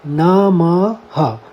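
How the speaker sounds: नमः ending in a clear ह; visarga sounded. Shown as a demonstration. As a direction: stretch नमः and let a breathy ह close it. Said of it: "हम इसका उच्चारण करेंगे नमः".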